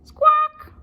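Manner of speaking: very high loud bird squawk; loud parrot cry. Acted. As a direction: screech like a parrot. Parrot noise.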